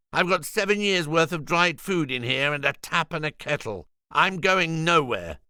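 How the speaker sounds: a barricaded vegan taunting the security men; defiant Julian. Acted. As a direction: dig in and refuse to budge. Julian defiant through door.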